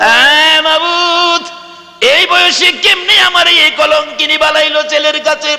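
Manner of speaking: long sung wail, high and drawn out; keening lament; mother's wailing cry. Acted. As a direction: cry out to God like a shamed old mother. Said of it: "মা একটা চিৎকার মারছে".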